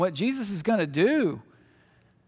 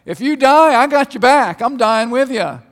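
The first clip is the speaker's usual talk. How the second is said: loud, high, eager buddy voice; eager loyal Thomas; 4 semitones higher, 11 dB louder than usual. Retold or imitated. Preacher voicing Thomas, loud and eager. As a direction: shout your loyalty to Jesus. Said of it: "And Thomas is like".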